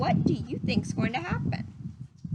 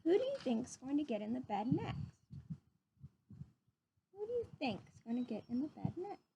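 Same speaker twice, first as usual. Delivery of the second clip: put-on reading to children. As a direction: read it gently to little listeners. Reading picture book to children.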